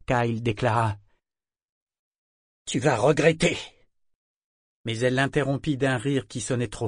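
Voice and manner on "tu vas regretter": higher, louder shaken threat; threat in fear and rage. Acted. Kyle threatening the figurehead, fear and rage. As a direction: threaten her though afraid. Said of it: "D'une voix que la peur et la rage faisaient trembler".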